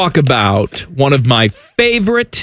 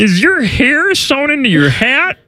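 high, squeezed puppet-frog voice; Kermit; 8 semitones higher than usual. Acted. Kermit quizzing his co-host on the hat, high and strained. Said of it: "do you like the Kermit voice".

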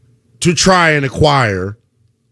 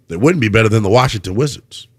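low, brisk deadpan; straight-faced; 7 semitones lower, faster than usual. Real, not acted. Host needles the Washington Wizards with a straight face, deadpan. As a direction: toss it off lower and quicker with a straight face. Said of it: "say that with a straight face".

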